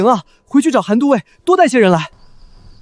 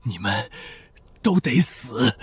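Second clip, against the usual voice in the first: low, very soft menace; faint, halting and menacing; strained threat; 10 semitones lower, 11 dB quieter, much slower than usual. Acted. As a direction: force out a dying threat, barely voiced. Captured assassin threatens, strained.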